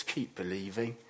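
gentle reassurance. Retold or imitated. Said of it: "Jesus just says to us".

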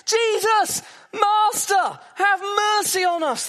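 high, loud desperate cry from far down the road; lepers shouting for mercy. Retold or imitated. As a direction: call out to Jesus across the distance, begging. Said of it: "they're shouting".

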